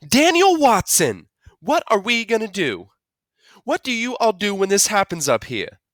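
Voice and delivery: high Southern-style fretting; worried Southern mother. A narrator's reading. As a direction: fret at your husband in a Southern accent. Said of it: "talking Southern style when she got worried".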